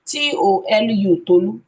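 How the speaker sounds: wrong way: "T O L U, Tolu" rattled off as one string; letters run together. Shown as a demonstration. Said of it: "on no account should you encourage them".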